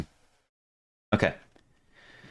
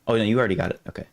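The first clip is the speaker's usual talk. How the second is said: ordinary talk, nothing marked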